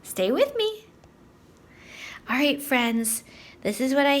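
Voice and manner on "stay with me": normal talking voice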